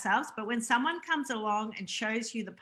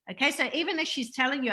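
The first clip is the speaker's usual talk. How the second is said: ordinary delivery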